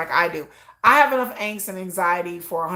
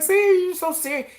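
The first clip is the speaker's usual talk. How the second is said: teasing husband; 9 semitones higher than usual. Retold or imitated.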